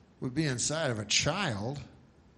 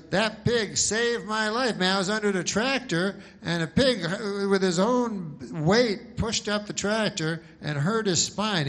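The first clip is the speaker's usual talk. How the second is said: mildly worked-up farmer, higher and louder; earnest boasting; 7 semitones higher, 5 dB louder than usual. Acted. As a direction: tell the rescue with raised, insistent pride. Farmer boasts of pig saving him.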